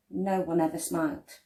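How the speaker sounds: usual speaking voice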